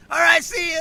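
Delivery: high, loud dismissive farewell; shouted goodbye. Retold or imitated. Man grabbing his dog from his ex, shouted goodbye. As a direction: holler a quick triumphant goodbye.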